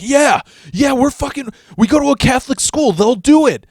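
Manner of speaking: very high, fast, loud excited voice; eager friend. Retold or imitated. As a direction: gush it out like it's genius. Friend eagerly pitching ambush plan.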